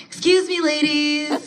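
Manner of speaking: very high, lilting sing-song; sweet and harmless, up high like a song. Retold or imitated. Passing women on a winter sidewalk in a high sing-song. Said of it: "I do my sing-song voice".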